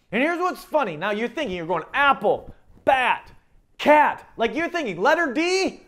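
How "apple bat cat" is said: building expectation. Retold or imitated. Listener guessing the pattern.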